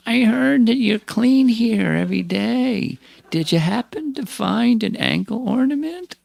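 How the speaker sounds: put-on high creaky voice of an old woman; creaky old lady voice. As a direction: crack it out like a frail old village woman. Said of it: "in a creaky voice".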